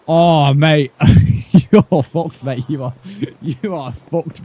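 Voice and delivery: real laugh breaking into the threat; high, incredulous, laughing as you doom him; laughing disbelief. Real, not acted. Player laughing at the villager who offered a candle, incredulous.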